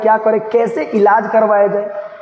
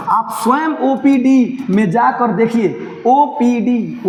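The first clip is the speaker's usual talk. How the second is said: slow reading; 3 semitones higher, much slower than usual. Shown as a demonstration. Reads dialogue slowly for class.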